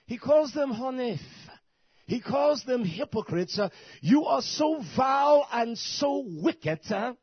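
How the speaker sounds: high, loud scripture denunciation; shouted, drawn-out pulpit condemnation; full-force preaching. Real, not acted. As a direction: cry out the rebuke, stretching the key words.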